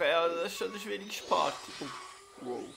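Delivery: ordinary delivery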